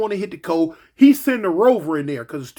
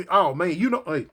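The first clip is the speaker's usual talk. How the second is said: ordinary delivery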